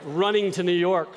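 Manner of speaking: usual speaking voice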